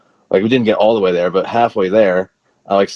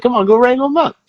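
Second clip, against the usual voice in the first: much higher-pitched imitation of a cop; mock egging on; faster than usual. Storyteller voicing cop to his partner, mock egging on. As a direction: urge him on in a high put-on voice.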